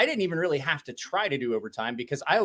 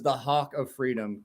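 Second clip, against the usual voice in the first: slow, lower, mock grand title; quoting Dong; 5 semitones lower, 4 dB quieter than usual. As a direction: announce it like a solemn title. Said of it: "Dong says".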